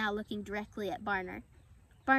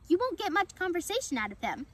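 higher-pitched little squirrel; 7 semitones higher, 3 dB louder than usual. A narrator's reading. Squirrel repeating itself to the fawn, high-pitched. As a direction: lift the pitch for a small chatty animal. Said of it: "the high voice belonged to the small".

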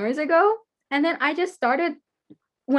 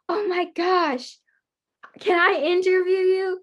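excited gasp; 6 semitones higher than usual. Retold or imitated.

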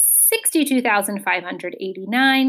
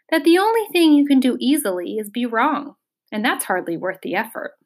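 ordinary delivery

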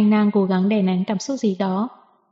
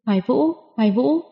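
mildly raised call; 3 semitones higher than usual. A narrator's reading. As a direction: call his name, a bit urgent. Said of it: "kêu lên".

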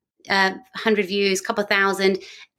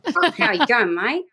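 put-on Australian accent; 4 semitones higher than usual. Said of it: "Every time I try and get the accent".

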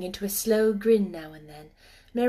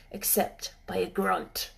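plain reading voice

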